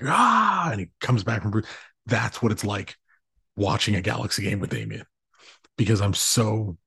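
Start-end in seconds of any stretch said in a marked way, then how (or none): 0.0-0.7 a sudden high strained yell as the Hulk bursts out; Hulk roar. Retold or imitated. Dad mimics the Hulk bursting out mid-story, high strained yell. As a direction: let it burst out like the Hulk losing control. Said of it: "Hulk turning, he's like".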